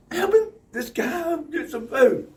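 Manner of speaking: high, slow, loud retort; hammed-up offended explaining; mock indignant. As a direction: spell it out indignantly. Retold reply, high slow.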